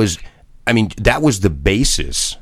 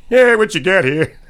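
put-on voice. Playful put-on high voice.

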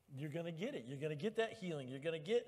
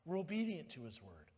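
normal talking voice

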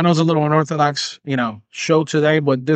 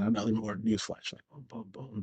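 ordinary delivery

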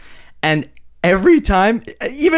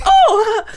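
high laughing squeal of offense; 6 dB louder than usual. Pretend. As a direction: shriek in fake shock. Mock outraged reaction.

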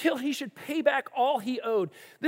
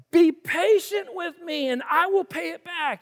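begging; 7 semitones higher, 4 dB louder than usual. A narrator's reading. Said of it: "begged him".

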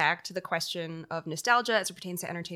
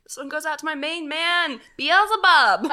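radio DJ voice; 7 semitones higher, 9 dB louder than usual. Pretend. Mock radio dedication to devil.

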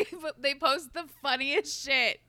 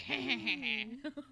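evil cackle. Shown as a demonstration. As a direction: cackle like a little troll. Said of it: "That's my evil cackle".

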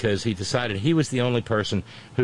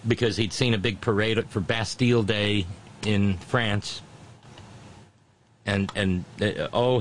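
usual speaking voice

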